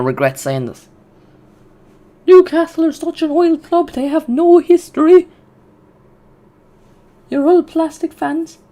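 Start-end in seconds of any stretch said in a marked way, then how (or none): 2.3-8.6 much higher, mocking taunt voice; taunting Newcastle fans in a high mock voice; mocking rival fan. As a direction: squeak it out like a jeering rival supporter. Mocking what critics will say.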